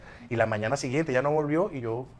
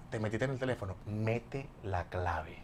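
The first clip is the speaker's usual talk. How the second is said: quiet menace; 3 semitones lower, 13 dB quieter, slower than usual. Retold or imitated. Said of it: "Así".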